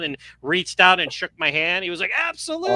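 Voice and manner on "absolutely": absolutely, pitched much higher with a rise; eager yes. Retold or imitated. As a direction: beam as you agree, lifting up. Actor smiles, shakes hand.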